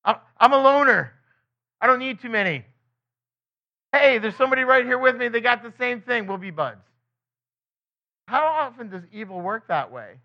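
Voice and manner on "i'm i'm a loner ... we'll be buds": mock bravado from a hardened loner. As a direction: brush it off like a tough loner. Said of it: "a hardened person might say".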